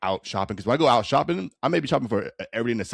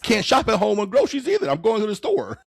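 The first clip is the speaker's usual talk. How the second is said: squeaky teasing voice much higher; high mock voice; 13 semitones higher, 4 dB louder than usual. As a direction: toss it off in a high silly voice.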